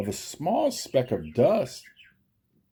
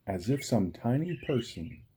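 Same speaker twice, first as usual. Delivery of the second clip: usual speaking voice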